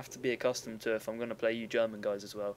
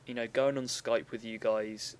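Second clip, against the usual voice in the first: normal talking voice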